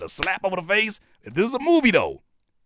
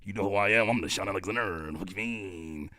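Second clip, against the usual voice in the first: mock smooth talker; 5 semitones lower, 6 dB quieter than usual. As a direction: imitate a self-styled player, dropped low and quiet. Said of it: "the way you talking to her, you thinking like".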